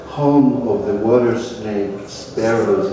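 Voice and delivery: ordinary delivery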